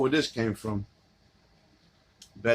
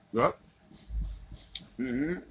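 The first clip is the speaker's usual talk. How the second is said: usual speaking voice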